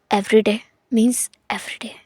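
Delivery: slow, breathy whisper of a teasing line; drawn-out whisper, barely voiced. Husband whispering a tease to shy Wing, slowly. Whispered aside during flirting scene.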